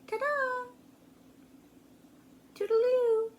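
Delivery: high, singsong bye; high playful farewell; playful cheer. Retold or imitated. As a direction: wave him off playfully, high. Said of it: "There she is saying".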